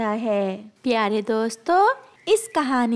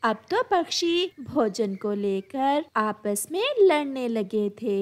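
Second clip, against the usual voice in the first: ordinary delivery, nothing marked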